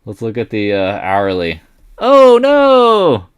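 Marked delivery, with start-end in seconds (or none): 2.0-3.3 high, loud long wail; drawn-out high no; alarmed cry. Real, not acted. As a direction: wail at the falling chart. Chart drops suddenly.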